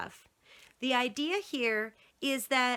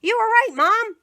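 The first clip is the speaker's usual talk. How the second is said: high loud call from another room; 11 semitones higher, 9 dB louder than usual. Acted. Boy calling to mother from bedroom, high loud call. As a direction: call out across the house. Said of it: "Spencer called from his bedroom".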